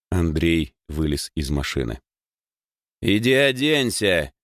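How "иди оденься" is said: slow, raised-pitch order; повелительно. A narrator's reading. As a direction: give a drawn-out command. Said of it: "велел ему пижон". (повелительным тоном)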